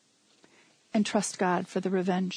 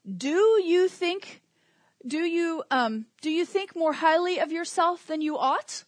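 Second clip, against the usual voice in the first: mock question; 6 semitones higher than usual. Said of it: "Paul could have kept it going".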